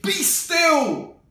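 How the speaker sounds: high, loud command; shouted command as Max. Acted. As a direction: order the monsters to freeze. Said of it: "Till Max said".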